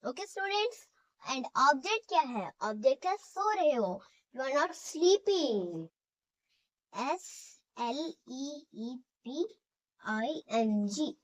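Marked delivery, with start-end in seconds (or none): 4.4-5.9 very slow, word by word, for students to write; slow dictation. Shown as a demonstration. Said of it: "dictation to students". 6.9-11.1 spelling letters slowly. Shown as a demonstration. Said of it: "spelling out a word".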